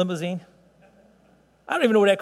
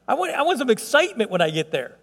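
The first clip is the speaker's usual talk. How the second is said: ordinary talk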